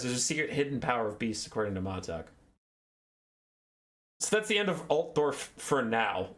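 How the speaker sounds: usual speaking voice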